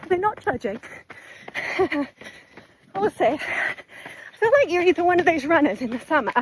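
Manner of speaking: out of breath, talking mid-run; panting between short phrases; while running. Real, not acted. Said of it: "my breathing is just all over the place".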